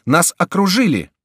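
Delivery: high, loud shout of alarm; alarmed cry. A narrator's reading. Monk running in with news of troops, alarmed cry. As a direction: shout the news as you run in. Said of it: "вскричал прибежавший монах".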